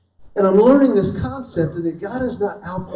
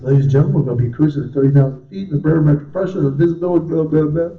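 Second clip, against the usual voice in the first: louder, rattled-off cockpit patter; mock captain's announcement, hurried into blahs; airline pilot announcement; 3 semitones lower, 4 dB louder, faster than usual. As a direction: imitate a pilot droning through flight details. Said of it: "the pilot's like".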